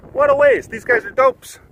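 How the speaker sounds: high-pitched mocking passer-by; hammed-up onlooker disdain; mock scorn. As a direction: scoff in a high voice, imitating onlookers.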